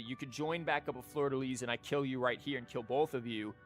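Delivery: ordinary talk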